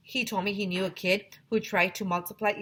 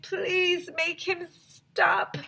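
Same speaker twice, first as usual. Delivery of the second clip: high, slow begging plea; 12 semitones higher, much slower than usual. Acted. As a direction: plead slowly in a high kid's voice. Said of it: "begged Neil the new kid".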